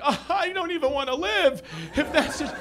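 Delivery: high, hammed-up wail; mock despair. Comic reliving koala extinction news, mock despairing wail. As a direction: wail as if life is over.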